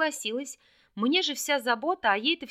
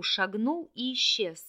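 ordinary delivery

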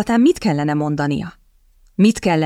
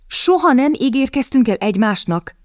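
higher, disbelieving question on soha; incredulous echo; 7 semitones higher than usual. A narrator's reading. Kitty echoing her suitor's words, incredulous and higher. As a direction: throw his own words back at him in disbelief.

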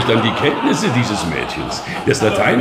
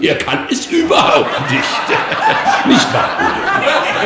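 mocking laughter. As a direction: taunt him through the laughter. Bishop mocks Odo, laughing.

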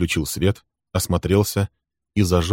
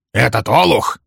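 higher, louder angry voice; 4 semitones higher, 5 dB louder than usual. A narrator's reading. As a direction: Walter cursing the young man, angry. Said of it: "начал сердито Вальтер".

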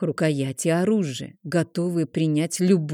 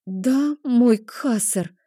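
frightened, submissive; 5 semitones higher, much slower than usual. A narrator's reading. Frightened slave answering master.

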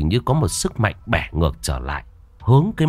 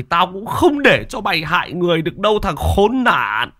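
outraged father's roar, rising in pitch; angry, heated scolding; furious yelling; 5 semitones higher than usual. A narrator's reading. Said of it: "mà gào lên".

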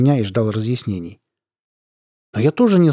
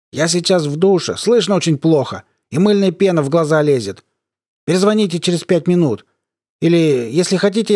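raised, higher voice over a bad line; to someone hard of hearing; 6 semitones higher than usual. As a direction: call out as if the line is breaking up. Fakes shower, bad line.

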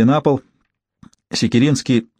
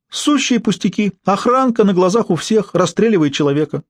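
high-pitched mock-naive exclamation, climbing as it goes; thin girlish gasp; 8 semitones higher than usual. A narrator's reading. Lebedev answering whether anything seemed strange, in a thin, girlish voice. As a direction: exclaim thinly like a swooning schoolgirl. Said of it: "охнул тоненько, не хуже институтки".

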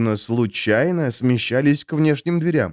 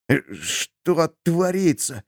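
trembling voice; 5 semitones higher, slower than usual. Acted. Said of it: "дрожащим голосом".

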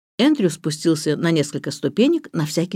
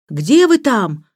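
louder, higher call into the distance; shouted question; 5 semitones higher, 5 dB louder, slower than usual. A narrator's reading. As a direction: call up to someone out of sight. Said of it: "прокричал Эндрю". (окликает криком)

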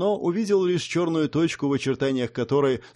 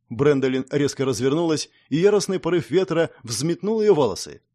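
plain reading voice

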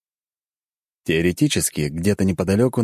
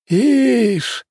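house spirit; 5 dB louder than usual. Acted.

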